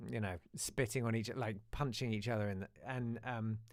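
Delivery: ordinary talk, nothing marked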